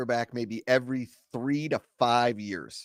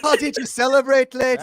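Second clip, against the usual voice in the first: high, hammed-up Irish accent; a deliberately terrible Irish accent; bad Irish accent; 9 semitones higher, 5 dB louder than usual. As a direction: play the cheery Irish host badly. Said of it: "Give us the Irish accent".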